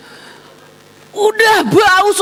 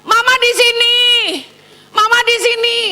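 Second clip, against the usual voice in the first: retold mum's call, pitched up; mock yelling; 7 semitones higher than usual. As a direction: imitate calling to a crying child from another room. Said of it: "saya teriak-teriak".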